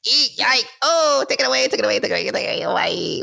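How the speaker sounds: high squeaky panicked shrieks; rubbery high squeaky toy. Retold or imitated. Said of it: "my gut reaction ... is like".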